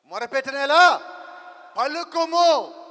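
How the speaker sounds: God commanding Moses to speak, booming and slow; booming divine command. Acted.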